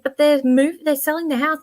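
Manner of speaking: high-pitched, exasperated retort; upset protest. Retold or imitated. Acting out own upset protest.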